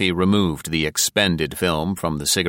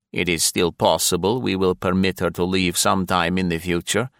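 cold, clipped foreign officer voice; sneering secret police major; menacing officer; 4 semitones higher than usual. Acted. Voices Satens, villain.

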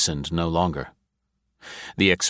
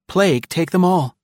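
much higher, louder angry outburst; exploding; 10 semitones higher, 4 dB louder than usual. Acted. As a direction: let the frustration burst out. Her frustration explodes after muttering.

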